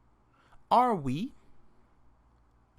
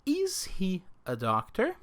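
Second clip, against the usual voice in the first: "Is he a doctor?" with the voice rising at the end; rising question tune. Shown as a demonstration. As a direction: ask it, lift the end of "doctor". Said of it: "the intonation".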